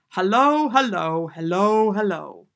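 bursting in with a hearty hello; boisterous greeting. Retold or imitated. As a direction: imitate a hearty visitor calling hello. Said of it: "but this time it was".

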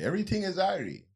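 mock Jamaican accent. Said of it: "Almost sound like she was gonna say".

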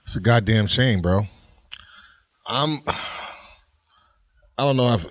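normal talking voice

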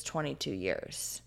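hushed retelling of a grave answer; quietly retold answer. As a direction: say it low and slow, imitating her.

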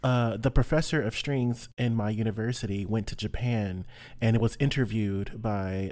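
usual speaking voice

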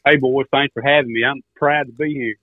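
usual speaking voice

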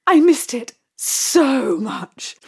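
breathy theatrical whisper; slow, hushed and melodramatic. Acted. Dramatic performer confession.

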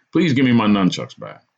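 plain reading voice

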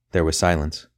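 ordinary delivery

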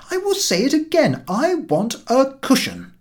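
a narrator's mild irritated insistence; getting angry. A narrator's reading. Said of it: "I was getting angry by this time".

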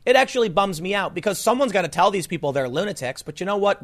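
ordinary talk

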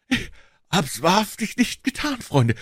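very high, tearful protest; sobbing. A narrator's reading. Accused man swearing innocence to his friends, sobbing. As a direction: plead innocence through sobs, voice way up high. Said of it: "schluchzte er".

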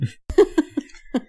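quick high-pitched laugh. Real, not acted. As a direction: laugh along. Laughs at the brother remark.

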